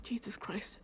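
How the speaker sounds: exasperated whisper after a sigh; sigh, then whisper it. Retold or imitated. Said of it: "I'm like".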